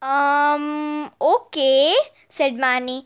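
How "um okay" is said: long hesitant um, rising okay; tentative, drawn-out agreement; a little unsure. A narrator's reading. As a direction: agree with some doubt. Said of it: "a little unsure".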